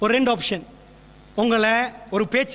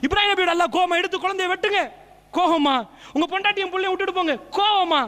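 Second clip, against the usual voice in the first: raised, insistent preaching voice; high-pitched, urgent sermon delivery; impassioned preaching; 6 semitones higher than usual. Real, not acted. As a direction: press each rhetorical question on the congregation, pitched high. Preacher builds to full force.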